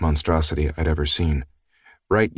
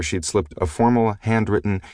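ordinary delivery, nothing marked

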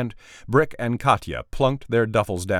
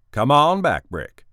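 calling out; 7 semitones higher, 7 dB louder than usual. Acted. Boss calls visitor in.

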